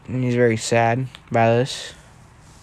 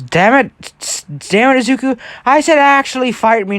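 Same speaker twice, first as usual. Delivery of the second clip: frustrated yell; 10 semitones higher, 4 dB louder than usual. Acted. Kitsuki angrily calls out Deku.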